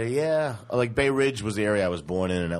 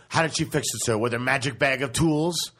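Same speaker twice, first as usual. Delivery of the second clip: snippy attendant; 6 semitones higher, 3 dB louder than usual. Retold or imitated. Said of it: "ran up to me and goes".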